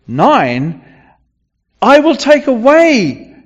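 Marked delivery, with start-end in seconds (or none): 1.8-3.3 declaring God. Acted. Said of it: "God is speaking here".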